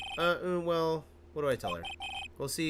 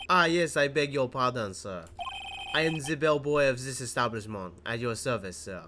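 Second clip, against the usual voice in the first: put-on louder bellboy with a French accent; a polite French hotel bellboy greeting; hammed-up French accent, bowing and scraping; 4 dB louder than usual. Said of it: "I get to do a French accent".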